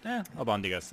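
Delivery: Spanish pronunciation of albondigas. Acted.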